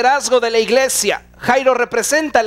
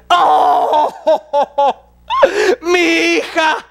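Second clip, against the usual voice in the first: anguished wail, higher and slow; crying out; 5 semitones higher, much slower than usual. Retold or imitated. Jairo hearing his daughter died, wailing cry. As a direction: break into a grieving cry. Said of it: "se pone a llorar".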